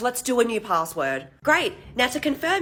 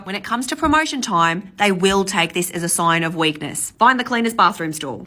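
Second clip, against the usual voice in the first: normal talking voice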